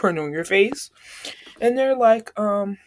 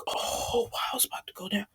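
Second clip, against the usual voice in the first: hushed whisper; 9 dB quieter than usual. Retold or imitated. Classmates hushed before the fight.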